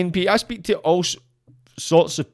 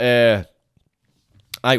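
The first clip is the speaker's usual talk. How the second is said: normal talking voice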